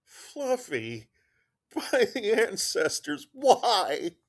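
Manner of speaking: high, strained snarl. Acted. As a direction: spit out each word like a cornered cat. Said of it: "Fluffy snarled".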